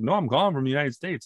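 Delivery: put-on heavy Jamaican accent. Caller denying he's in Jamaica, heavy Jamaican accent. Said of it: "you have a very heavy accent".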